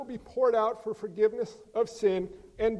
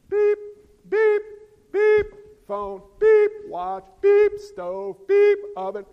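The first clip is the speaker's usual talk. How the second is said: much higher and louder sharp beeping; imitated beeps; 10 semitones higher, 9 dB louder, slower than usual. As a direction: mimic shrill appliance beeps.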